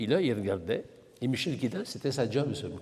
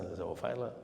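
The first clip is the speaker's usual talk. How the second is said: ordinary talk, nothing marked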